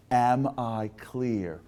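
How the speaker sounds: very slow, drawn-out stern check; stern coach. Retold or imitated. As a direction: stretch it out like a coach demanding obedience. Coach repeats demand to player.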